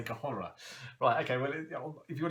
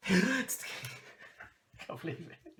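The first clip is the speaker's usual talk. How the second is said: delighted laugh; 8 semitones higher than usual. Real, not acted. Laughing with delight as the needed key card turns up. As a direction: burst out laughing with relief.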